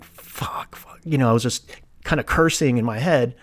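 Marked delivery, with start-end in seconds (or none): 0.0-1.0 whispered retold curse; under his breath. Patient cursing to himself walking down the hall, under his breath. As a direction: swear quietly to yourself. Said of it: "under my breath".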